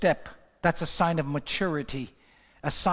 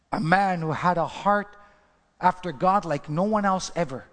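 normal talking voice

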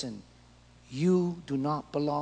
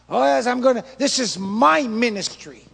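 louder, high, self-important claim; proud pastor; 6 semitones higher, 10 dB louder than usual. Acted. Preacher mocking a pastor claiming the ministry, proud. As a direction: brag like a possessive pastor.

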